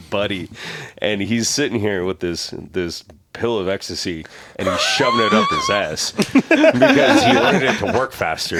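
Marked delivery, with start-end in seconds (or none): 4.6-8.6 laughing. Real, not acted. Laughing retelling of friend's act.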